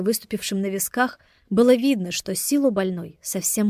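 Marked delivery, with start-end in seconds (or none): none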